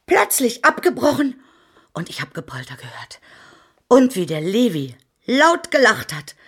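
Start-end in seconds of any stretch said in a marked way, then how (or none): 1.9-3.2 low, ghostly whisper; a creepy hushed telling. Acted. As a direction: drop to a softer, lower whisper. Describing frightening noises.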